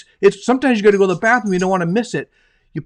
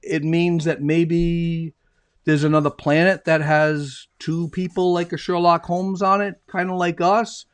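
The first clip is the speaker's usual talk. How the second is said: very slow, musing Watson; slow speculation. Acted. Watson wondering about another planet, very slow. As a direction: spin out the thought slowly.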